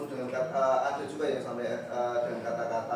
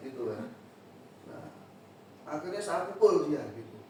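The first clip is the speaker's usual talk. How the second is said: normal talking voice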